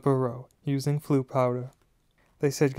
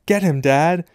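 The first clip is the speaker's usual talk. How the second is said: loud, raised cheering-on yell; 4 semitones higher, 7 dB louder than usual. A narrator's reading. Son egging his dad on in a fight, yelling. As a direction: yell it to egg him on. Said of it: "There was a yell of".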